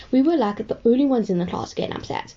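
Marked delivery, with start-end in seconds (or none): none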